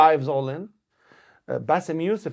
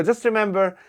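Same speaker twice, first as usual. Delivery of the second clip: higher, louder, fast stage host voice; cheesy opening-act announcer, higher and louder; retold emcee announcement; 6 semitones higher, 6 dB louder than usual. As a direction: pump up the crowd like a show MC. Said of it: "And I go".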